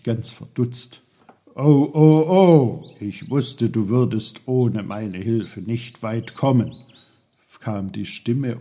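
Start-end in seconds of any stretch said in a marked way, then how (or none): 1.5-6.7 loud, bursting know-it-all voice; a loud, cheeky little helper; know-it-all little uncle, teasing. Acted. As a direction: barge in loudly with a teasing "oh, oh, oh". Voices Onkel Pfefferkorn.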